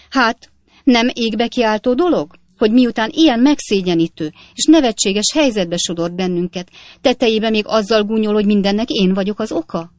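wife crying out at her husband, outraged; indignant cry. Acted. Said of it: "kiáltotta Napkinsné".

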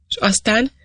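a touch of urgent curiosity; exclaimed question. A narrator's reading. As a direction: cry out the question, understated. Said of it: "kiáltott föl Harry".

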